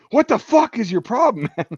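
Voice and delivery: mock angry outburst, high and loud; imitating an irate bunkmate. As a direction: retell it as a loud, high-pitched protest. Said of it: "Brunson's like".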